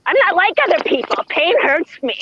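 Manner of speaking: quick, high-pitched mock whine; high cartoonish self-pitying complaint; whining Daffy quote. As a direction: whine it obnoxiously, pitched well up. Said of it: "I'm just whining and can't stop".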